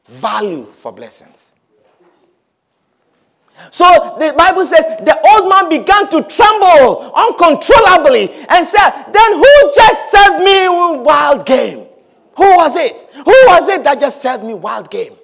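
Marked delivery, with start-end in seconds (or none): 3.7-8.9 loud, high preacher's build; fiery preaching. Acted. Preaching at full force. 9.1-15.2 loud, high, slow alarmed question; shaken old father. Acted. Trembling Isaac questions.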